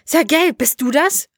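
high, hopeful question into the radio; excited calling. A narrator's reading. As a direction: call out, hardly believing it. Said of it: "rief er an das Gerät".

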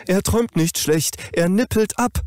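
high, loud, pressing machine voice; frantic smart mattress; urgent mattress voice. Acted. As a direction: blurt it out as top-priority alarm. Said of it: "mit höchster Priorität".

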